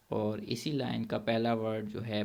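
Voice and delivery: ordinary delivery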